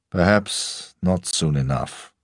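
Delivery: a hint of a Russian accent, understated menace; Russian-English accent. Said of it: "the accent forever caught somewhere between rural England and his Russian homeland".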